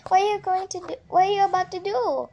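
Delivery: nervous gasp. Acted. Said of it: "Tootie gasped".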